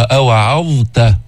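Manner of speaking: "أوعظت" drawn out, ẓā given its time; slow clear ẓā. Shown as a demonstration. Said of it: "الضاء بدها وقت لتلفظها". ("أوعظت" ببطء وإظهار الظاء)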